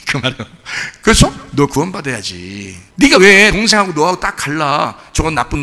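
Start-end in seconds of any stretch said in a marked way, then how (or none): 1.5-2.8 low, soft and slow; tender father. Retold or imitated. Father tells elder son softly he needs saving. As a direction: tell him gently he must be saved. Father's meaning voiced slowly.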